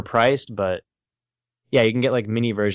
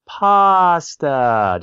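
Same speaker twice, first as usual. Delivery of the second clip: loud, drawn-out Pasta; slow cartoon Italy; 7 semitones higher, 6 dB louder than usual. Cartoon Italy's only line, said slow and loud. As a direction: stretch Pasta out slowly and loudly. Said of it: "just really slow".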